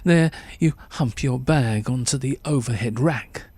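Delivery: usual speaking voice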